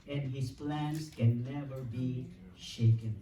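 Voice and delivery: fervent pulpit preaching, building. Real, not acted. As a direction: proclaim it to the congregation. Preaching at full force.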